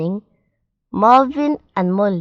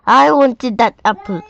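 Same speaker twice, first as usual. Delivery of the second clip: loud, higher-pitched protest; angry shout; 4 semitones higher, 8 dB louder than usual. A narrator's reading. Marvin to Molly, who ate his apple, angry shout. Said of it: "shouted Marvin".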